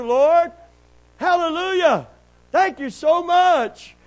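loud, high preacher's exultation; amazed jubilation. Retold or imitated. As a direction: shout your astonished thanks to the Lord. Amazed thanks for unexpected blessing.

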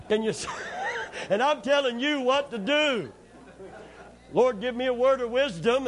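loud, high laughing preaching. Real, not acted. Preacher laughing at paying the dentist through the nose, loud and high. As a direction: crack up while driving the joke home.

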